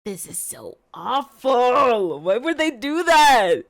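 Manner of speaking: loud and high, pained disbelief; anguished outburst. Real, not acted. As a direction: cry out at how unfair it is. Outburst at the news.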